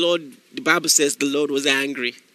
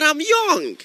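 put-on Nigerian accent; 9 semitones higher than usual. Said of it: "specifically like a Nigerian".

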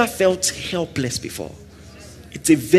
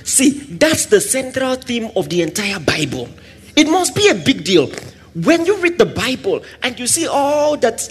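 ordinary delivery

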